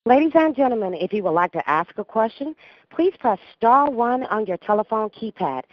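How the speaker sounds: conference call operator announcement; crisp announcement over phone line. Real, not acted. Call operator opens question queue.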